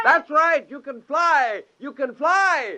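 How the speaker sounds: thrilled at the first flight; elated praise. Acted. Scientist cheering creature's first flight, elated.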